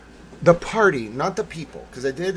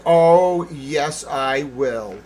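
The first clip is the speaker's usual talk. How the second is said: loud, drawn-out "oh", then firm; retold defiance; 10 dB louder, much slower than usual. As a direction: loudly defy someone pleading with you. Retold defiant answer to pleading.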